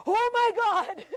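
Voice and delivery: high-pitched mock "oh my God" of shock; startled officer. Police officer facing a driver's sudden breakdown, mock shocked.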